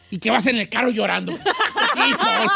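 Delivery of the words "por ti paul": high, laughing mock sob to Paul; laughing fake-tearful dedication; mock weepy tribute. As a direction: ham up crying in the car. Mock car crying tribute.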